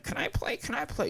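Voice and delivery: first a soft whisper, then a high squeaky beg; pleading little sister. Retold or imitated. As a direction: mimic a small sister begging to join, voice jumping high. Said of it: "your sister would be like".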